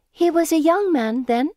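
high, loud leading question; prodding. A narrator's reading. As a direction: probe eagerly, lifting the end. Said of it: "Cecily prods".

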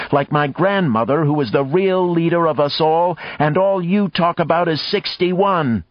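voiced young woman, bitter and accusing; angry accusation. A narrator's reading. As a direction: lash out at her in disgust. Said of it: "Jackie cried".

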